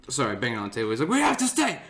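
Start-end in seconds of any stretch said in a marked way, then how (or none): 1.1-1.8 high-pitched mock stubbornness; imitated belligerent insistence. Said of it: "he's fucking belligerent at this point".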